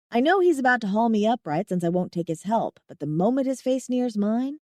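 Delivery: ordinary delivery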